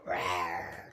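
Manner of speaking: low growled rawr; lion roar. Pretend. Teacher growling a lion roar for children.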